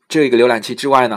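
ordinary talk

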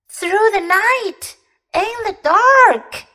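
high, slow, alarmed cry; frightened exclaim. A narrator's reading. Scared little bear exclaiming at his friend's dream. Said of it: "Fuzzy exclaimed".

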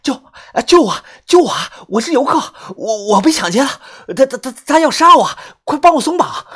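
high, loud, stammering cry for help; panicked gasping plea. A narrator's reading. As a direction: gasp out each word in terror, pitched high. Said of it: "他急促地喘着气说道".

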